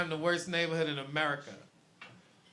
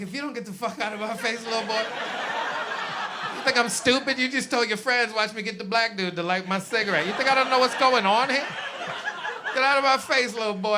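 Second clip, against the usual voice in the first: put-on raised, heated telling-off of the little boy; mock angry rant; 5 semitones higher, 4 dB louder than usual. Comic telling off a teenager who asked him to light a cigarette, mock angry. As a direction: read the kid the riot act, half-performed.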